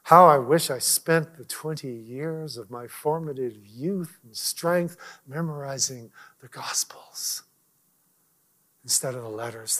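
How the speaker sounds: slowing into a soft whisper; hushed regret. Retold or imitated. Daughter heard him confess his regret from the pulpit, whispered. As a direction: breathe the wish out like a confession.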